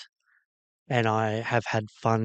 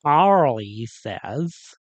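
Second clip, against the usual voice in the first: bad American accent; 2 semitones higher, 9 dB louder, much slower than usual. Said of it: "a really terrible attempt at an American accent".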